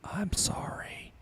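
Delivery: whisper. Real, not acted. Whispered apology.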